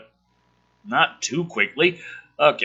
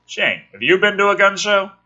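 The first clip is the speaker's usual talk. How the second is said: mocking repeat; 7 semitones higher, 4 dB louder than usual.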